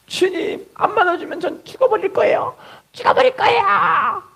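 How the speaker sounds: high, strained mock wail, slowing at the end; comic desperate threat to God; hammed-up desperate prayer. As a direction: plead melodramatically in a high voice. Acts an over-desperate threatening prayer.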